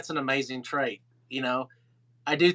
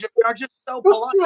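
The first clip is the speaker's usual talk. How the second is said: high, loud, quick Irish impersonation; Irish accent; 7 semitones higher, 6 dB louder, faster than usual. Retold or imitated. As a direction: exclaim it as an exasperated Irishman. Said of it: "[name] was in his Irish accent".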